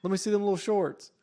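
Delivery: high-pitched mock youth slang; put-on slang voice. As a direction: ham up cringey kid lingo. Parodying youth slang to coach teen.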